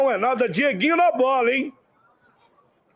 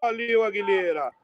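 play-by-play commentary; 4 semitones lower than usual. Acted. Live match commentary.

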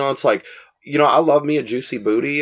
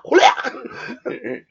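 laughing yell; 13 semitones higher than usual. Retold or imitated. The cry dissolves into laughter.

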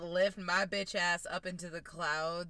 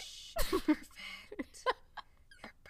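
mock whisper; much faster than usual. Soothing friend with fake whisper.

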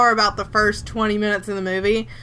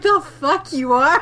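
higher, drawn-out scornful retort; mock disbelief; 5 semitones higher than usual. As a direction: imitate a sassy, incredulous comeback. Acted incredulous retort to the frog.

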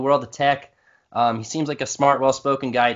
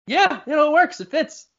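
high-pitched agreement; 12 semitones higher than usual. Acted. Imagined group approving a pick.

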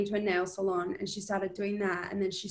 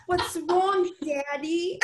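put-on high-pitched, louder Brooklyn accent; sassy Brooklyn accent, pitched up; Margot Robbie; 6 semitones higher, 4 dB louder, much slower than usual. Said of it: "Can you do a Margot Robbie".

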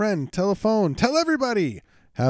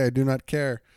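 ordinary talk, nothing marked

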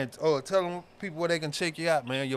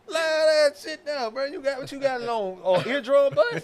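rattled shouting; 9 semitones higher than usual. Real, not acted. Still rattled after the scream.

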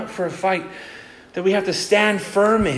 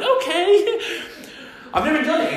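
nervous laughing; 10 semitones higher than usual. Retold or imitated. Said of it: "And I went".

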